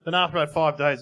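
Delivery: ordinary talk, nothing marked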